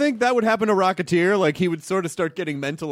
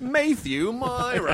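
slow, higher mock English announcing; English newscaster accent; 6 semitones higher, much slower than usual. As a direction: announce the name like an English newsreader. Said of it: "like a newscaster in England".

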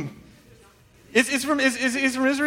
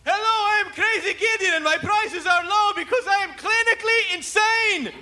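much higher hammed-up TV pitchman voice; Crazy Gideon; 11 semitones higher than usual. Comedian imagining the ad, high manic pitchman. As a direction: sell it like an unhinged late-night huckster. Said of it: "come out and go".

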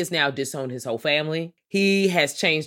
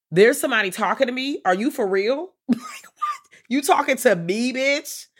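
mock astonished; 7 semitones higher, 3 dB louder than usual. Fester's astonished quote.

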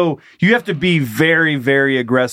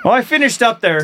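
high, chirpy Minnesota husband; hammy pitched-up Minnesota lilt; Minnesota leprechaun voice; 7 semitones higher than usual. Acted. Husband signing off after finishing, comic Minnesota accent. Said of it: "sounded like Minnesota leprechauns".

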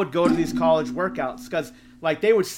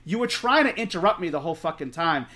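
ordinary talk, nothing marked

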